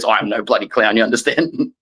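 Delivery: low, gruff Aussie telling off a reporter; imitating a fed-up Australian coach; Australian coach impression. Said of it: "teachers in my life say that exact word".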